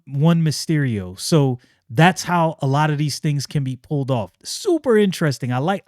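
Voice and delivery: ordinary talk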